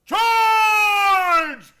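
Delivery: a full-throated bugle-charge shout; battle cry. Acted. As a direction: roar the charge up the stairs like leading troops. Colonel charges up the stairs.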